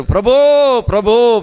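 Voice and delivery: high, long-held call to the Lord; imitated crying out. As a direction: act out a blind man's calls, easing off on the repeat. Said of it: "గట్టిగా కేకలు వేయడం ప్రారంభించాడు". (గట్టిగా ప్రభువును పిలుస్తున్నట్టు అనుకరణ)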